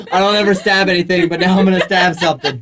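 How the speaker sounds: loud and laughing, gleeful. Real, not acted. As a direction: declare it with giddy glee. Excited gleeful stab declaration.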